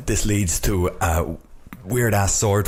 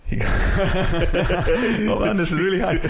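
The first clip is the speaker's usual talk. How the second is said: laughing; 12 semitones higher, much slower than usual. Real, not acted. Laughing while timed recap.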